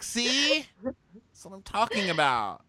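very high, whiny imitation; mock squealed complaint. Bachelorette proving her point to suitor, high mock exasperation. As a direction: squeak it out, then drag the ending.

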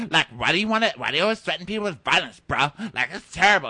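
imitating a pestering bro; mock needling. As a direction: needle him like an indignant bro. Acted needling of Chad.

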